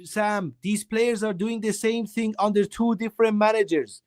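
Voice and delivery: heated shout. Real, not acted. Fan arguing with co-host over the manager, heated high shout. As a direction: raise the voice to win the argument.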